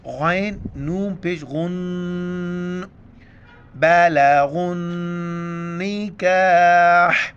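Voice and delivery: غن with the nun hidden in the nose, slow; nasal ghunna. Shown as a demonstration. Teacher shows ghunna on غن, nasal and slow. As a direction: hum غن through the nose, drawn out. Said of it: "ناک میں آواز چھپانا".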